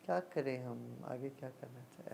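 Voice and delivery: low, soft musing to oneself; deep in thought. Acted. Teacher acting out someone planning the next step, pensive. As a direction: ponder quietly, voice sinking away. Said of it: "यदि कुछ गहराई से सोचना है".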